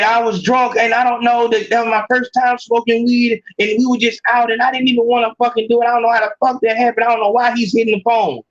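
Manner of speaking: mock excuses rattled off fast; hurried, half-hearted imitation of a cheating girlfriend; rushed excuses. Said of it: "if she said".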